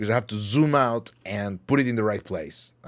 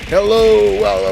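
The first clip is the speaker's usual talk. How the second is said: much higher, loud startled hello; startled squeak; 11 semitones higher, 11 dB louder, slower than usual. Real, not acted. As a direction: jump at the surprise. Sudden flustered squeal.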